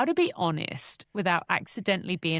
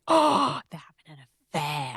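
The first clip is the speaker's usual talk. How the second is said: mock scandalised; 3 semitones lower than usual. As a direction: gasp at the juicy news, then confide it. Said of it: "she did do it a bit scandally".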